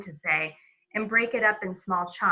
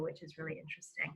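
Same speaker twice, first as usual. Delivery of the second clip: whispered aside; 3 semitones lower, 16 dB quieter, faster than usual. Real, not acted. Aside during enunciation lesson.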